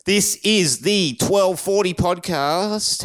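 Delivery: high, drawn-out show intro; podcast announcer. Acted. Host opening a hangover podcast, announcer style.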